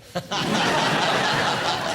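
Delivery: a real burst of high laughter; high breathy laugh. Real, not acted. Laughing at his mum's remark about Reading. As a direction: laugh out loud, high and airy.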